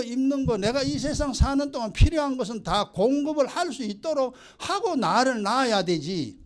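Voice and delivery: put-on resentful kid talking back to his parents; complaining child. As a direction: whine it up high like a wronged child.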